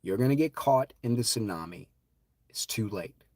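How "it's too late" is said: soft unvoiced whisper. Real, not acted. Telling listeners they waited too long, whispered. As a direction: hush it like a grim secret. Repeats the warning.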